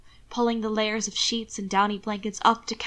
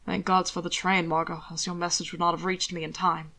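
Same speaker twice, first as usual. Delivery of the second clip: lightly lower, composed gentleman; calm lord; 4 semitones lower, 3 dB quieter than usual. Acted. Hannibal calmly reassures Margot, lightly lower voice. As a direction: keep it low and measured.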